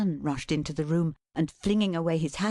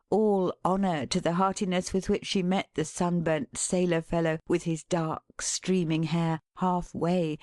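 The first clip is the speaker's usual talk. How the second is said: ordinary delivery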